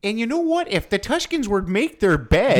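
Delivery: high, strained Canadian lecturing voice; Jordan Peterson. Acted. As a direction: scold like a preachy Canadian professor. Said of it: "Sounds like Jordan Peterson".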